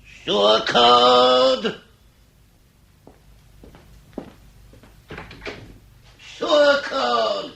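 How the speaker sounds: loud, high shout across the house; calling out. Acted. Master shouting for his missing servant, loud call. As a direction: bellow the name to summon him.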